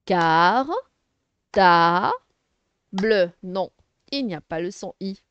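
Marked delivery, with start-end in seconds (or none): 0.0-3.3 "Car, ta, bleu" in three loud, separated beats; syllable by syllable. Shown as a demonstration. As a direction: break "Car, ta, bleu" apart with long gaps. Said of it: "les syllabes".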